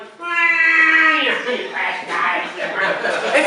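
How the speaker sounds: high, loud hammed-up squawk of a hit duck; mock duck squawk. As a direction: squawk like a shot duck, half laughing. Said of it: "the duck's like".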